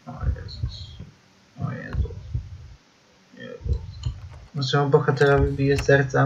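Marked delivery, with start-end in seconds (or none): 0.1-3.8 breathless scared whisper; frightened whisper. Real, not acted. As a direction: whisper it in fear. Frightened whisper in horror game.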